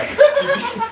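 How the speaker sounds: high loud laugh; burst of laughter. Real, not acted. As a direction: laugh out at your own slip. Laughs after fumbling alphabet.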